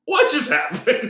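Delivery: high, slow, baffled question; utterly thrown by what she saw; stunned disbelief. Retold or imitated. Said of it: "And I went".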